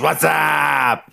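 hammed-up What's Up impression; imitating the What's Up call. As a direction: bellow What's up like the old sketch. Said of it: "He still goes".

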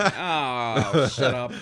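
loud exasperated groan of a retort; annoyed eye-roll. Retold or imitated. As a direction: brush it off, fed up. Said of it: "I'm like".

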